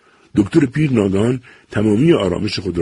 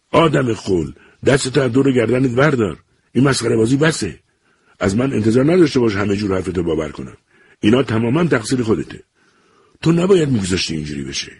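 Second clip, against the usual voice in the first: scolding father; 6 semitones higher than usual. A narrator's reading. Father scolding his son.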